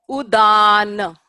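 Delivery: "Udana" slow, the middle a held long; modeled pronunciation. Shown as a demonstration. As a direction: drill "Udana" for the class. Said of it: "Can everybody say that?".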